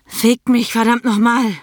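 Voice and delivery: a full, desperate cry; loud demand. A narrator's reading. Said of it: "„Lauter".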